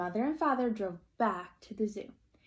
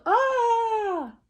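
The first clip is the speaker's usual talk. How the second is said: high, loud cry of pain; pained yell; 14 semitones higher, 10 dB louder than usual. Acted. Father bitten on the nose, loud high cry. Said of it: "He yelled".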